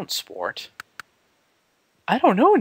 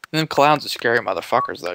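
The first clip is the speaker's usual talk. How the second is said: ordinary delivery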